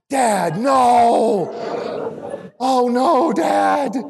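high, loud mock wail; imitated crying son. Son begging his dad not to say it, mock crying. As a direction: wail like a distraught kid, hammed-up. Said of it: "got emotional, started crying".

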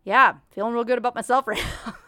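laughing at herself sarcastically; sarcastic. Real, not acted. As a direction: toss it off with wry self-deprecation. Self-mocking after being named like cow.

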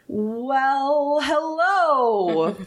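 lumbering turtle. Acted. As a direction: draw out a greeting like a big lumbering turtle. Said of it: "big, lumbering turtle".

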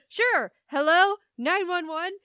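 high, loud sarcastic fake phone call; mock guy. Guy mocking the girl with a pretend call: high, loud sarcasm. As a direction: ham up a fake call for rescue. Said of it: "the guy's like".

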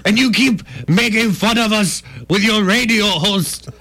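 high indignant French complaint; huffy Frenchman, ham it up; mock French accent. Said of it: "And it's like".

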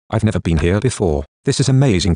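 ordinary delivery, nothing marked

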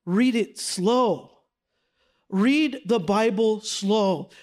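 "read it slow" drawn out very slowly. Shown as a demonstration. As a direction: stretch every word of "read it slow".

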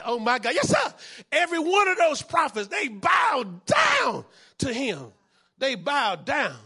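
loud, high shouted sermon climax; full-force preaching. Real, not acted. Pastor telling the prophets' story, shouted preaching. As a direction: build to the peak and shout it out.

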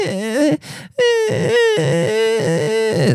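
put-on high, far-off whiny wail of a crying kid; mock child crying. Mocking the neighbour's kid crying through the wall, high whiny wail. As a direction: imitate the kid's endless crying, high and thin.

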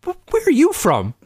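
high, incredulous stammer; squeaky, flabbergasted demand; retold stunned question. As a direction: act out total disbelief.

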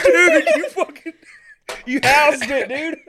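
laughing loud hype; frat bro. Real, not acted. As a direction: shout it through laughter. Cheering the finished chug.

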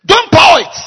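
loud, high command; booming voice from heaven; shouted again. Retold or imitated. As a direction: cry out to stop the pouring. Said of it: "another voice shouted".

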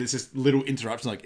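ordinary talk, nothing marked

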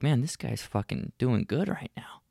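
whispered, impressed inner thought; imagined whisper. Retold or imitated. Wife's thoughts watching husband, whispered. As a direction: breathe it as her silent approval. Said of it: "She's looking at me like".

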